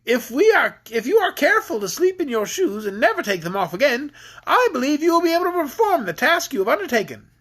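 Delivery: character voice. Acted. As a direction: lift the voice to play the adviser. Quoted character speaking in story.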